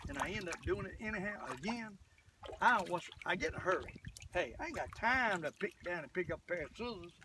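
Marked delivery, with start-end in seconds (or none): none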